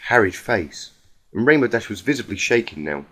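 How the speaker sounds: ordinary delivery, nothing marked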